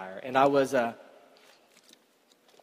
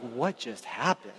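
stunned; 6 semitones lower, 9 dB quieter, slower than usual. Retold or imitated. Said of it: "I'm just like".

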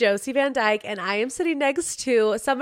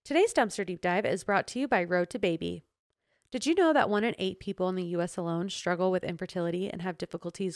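measured, scripted podcast ad voice; composed host-read advert, a little lower; sponsor ad read; 3 semitones lower, 5 dB quieter than usual. Acted. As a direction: read the sponsor copy evenly and clearly. Reads a sponsor ad.

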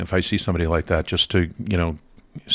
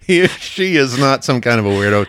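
laughing; 7 semitones higher, 5 dB louder than usual. Real, not acted. Laughing through the sentence.

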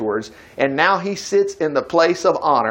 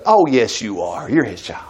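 gentle reassurance; 8 semitones lower, 3 dB quieter than usual. Real, not acted. Reassuring doubter he is God's child.

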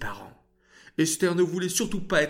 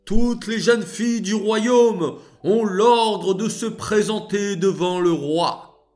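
loud, higher town-crier call; proclamation; 7 semitones higher, 7 dB louder than usual. Acted. Guards announcing to the kingdom, calling out loud. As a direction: cry it out across the land.

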